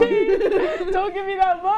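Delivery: high, slow, laughing plea; high giggling protest. Real, not acted. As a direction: laugh out the words. Laughing at friend.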